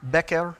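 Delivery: BECKER with a short E, second syllable opened to "air"; carefully sounded-out BECKER, short first vowel; tentative pronunciation. Shown as a demonstration. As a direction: try BECKER as "BECK-air". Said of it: "right pronunciation?".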